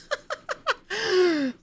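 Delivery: high-pitched burst of laughter; high, delighted laugh. Real, not acted. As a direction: laugh out loud at the irony. Laughs at ironic misspelling.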